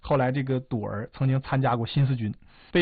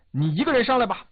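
high, loud call to the enemy; 高声喊话; 10 semitones higher, 5 dB louder, faster than usual. A narrator's reading. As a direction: raise the voice and call out, pitched well up. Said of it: "杨靖宇高声说". (高声向敌人喊话)

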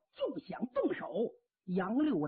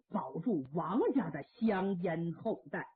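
plain reading voice

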